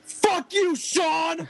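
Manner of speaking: high-pitched shouted chant; crowd scream. Retold or imitated. Singer rallies the crowd against bandmate, imitated scream. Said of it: "made all of them scream".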